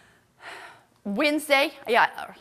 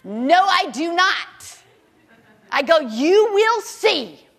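slow, louder and higher defiance; defiant; 4 semitones higher, 7 dB louder, much slower than usual. Retold or imitated. Telling the doctor she'll be healed, slow and defiant. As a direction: spell out each word, firm. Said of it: "I'm like".

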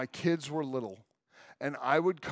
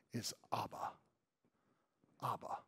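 soft, lower, hushed Abba; tender Abba; 6 semitones lower, 14 dB quieter than usual. Acted. Preacher introducing Abba to congregation, hushed tender. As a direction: breathe the word Abba gently.

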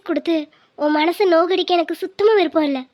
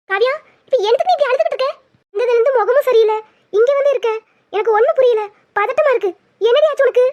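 anxious mother; 5 semitones higher than usual. Acted. Mother frets at her crying daughter, high and anxious.

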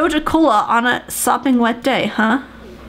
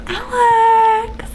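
swooning cry; 9 semitones higher than usual. Real, not acted. Swooning over Alex.

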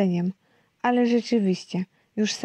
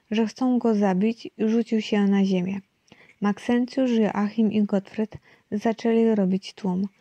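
ordinary delivery

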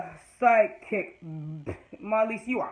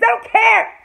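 much higher, loud fed-up outburst; exasperated yell; 12 semitones higher, 11 dB louder than usual. Real, not acted. Fan yelling she doesn't care about Cecile, loud. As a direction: blurt your boredom out. Ranting about skipped storyline.

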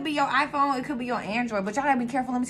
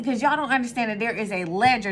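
ordinary delivery

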